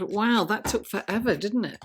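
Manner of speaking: normal talking voice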